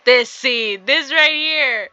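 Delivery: high, loud, drawn-out exclamation; real excitement. Real, not acted. Player recognising a familiar game scene, loud excited outburst. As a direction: burst out in delighted recognition.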